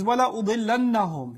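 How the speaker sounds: swift Quranic recitation; تلاوة سريعة; recited Arabic. Shown as a demonstration. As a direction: chant the verse quickly.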